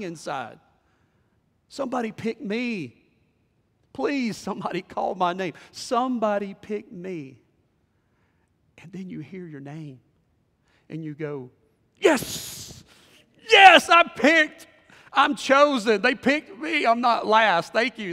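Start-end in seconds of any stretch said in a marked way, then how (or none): 1.7-7.4 higher, urgent silent begging acted out; acted-out anxious begging; desperate plea. Acting inner plea to be picked. 12.0-18.1 high, shouted burst of triumph; acted-out elated yelling; ecstatic celebration. Acting joy at being picked.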